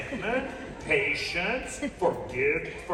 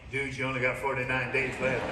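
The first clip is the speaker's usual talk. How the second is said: warning buddy; 6 semitones lower than usual. Retold or imitated. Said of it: "he's got buddies saying".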